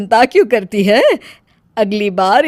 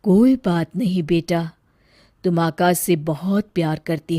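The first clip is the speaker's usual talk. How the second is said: soft, low, distant consoling voice; soothing ghost; 5 semitones lower, 5 dB quieter than usual. Acted. Mother's spirit consoling her weeping daughter, softly. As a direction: comfort her gently from beyond.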